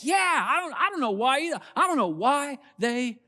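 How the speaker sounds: high, hammed-up chorus of agreement; agreeing crowd. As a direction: play the nodding in-group, eagerly. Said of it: "They're like".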